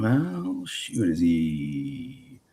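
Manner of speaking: drawn-out, wondering to himself; slow, half-mock suspicious thinking aloud; slow puzzled musing. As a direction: stretch each word like you're puzzling it out. Wondering aloud about a cop background.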